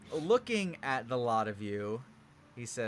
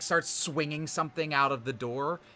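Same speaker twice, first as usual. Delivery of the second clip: usual speaking voice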